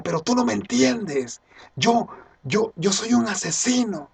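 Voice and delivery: high, strained-with-urgency imitation of a confession; acted desperate outburst. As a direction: act out a man desperate to be understood. Acts out desperate man's confession.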